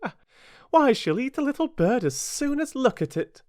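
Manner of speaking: lightly eager, lifting in pitch. A narrator's reading. Alice praising her cat to the birds, eagerly. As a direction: gush a little about a pet. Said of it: "Alice replied eagerly".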